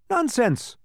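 high-pitched, fairly loud shout; Alec shouting. A narrator's reading. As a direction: call it out high from a branch. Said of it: "shouted Alec from the high branch".

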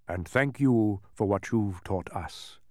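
low, slow kingly voice; stately king. A narrator's reading. King thanks the departing boy, stately. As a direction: answer slowly and low, as a king.

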